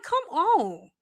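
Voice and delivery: usual speaking voice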